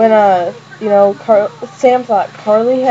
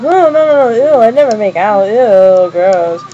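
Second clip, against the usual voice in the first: disgusted denial; 5 dB louder than usual. Retold or imitated. Retelling friends who both denied making out, disgusted denial. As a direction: imitate two friends hastily denying it, grossed out. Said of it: "they both denied it".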